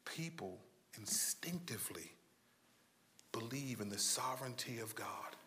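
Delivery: soft, low, drawn-in sermon voice; hushed preaching. Acted. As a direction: lean in and preach softly. Quiet intimate sermon passage.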